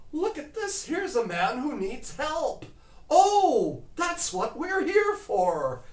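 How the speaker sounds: high, loud and eager, slowing at the end; suddenly thrilled to be useful; excited realization. Retold or imitated. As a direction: rescue workers delighted someone finally needs help. Guys realize they can help.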